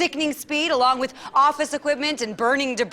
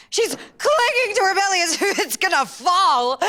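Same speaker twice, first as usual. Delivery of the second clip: higher, louder hammed-up sob in the voice; mock weeping; 8 semitones higher, 5 dB louder than usual. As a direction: overplay the tragedy with a fake cry. Mock tragic pathos.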